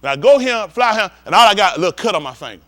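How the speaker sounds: ordinary delivery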